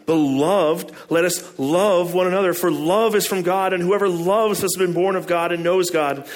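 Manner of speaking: emphatic reading. Acted. Pastor reading verses aloud to the church, emphatic. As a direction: proclaim the verses with force.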